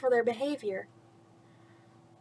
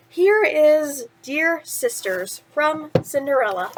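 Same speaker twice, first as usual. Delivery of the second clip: usual speaking voice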